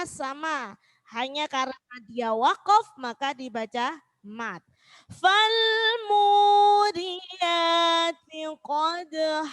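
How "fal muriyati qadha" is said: much higher, louder chanted verse; loud Quran recitation. Shown as a demonstration. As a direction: ring out the verse. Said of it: "recites the verse". (bacaan tartil lantang)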